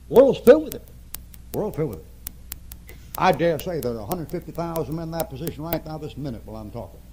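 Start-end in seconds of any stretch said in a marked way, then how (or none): 0.1-0.9 preached shout. Real, not acted. 1.5-3.0 quiet slow echo. Real, not acted.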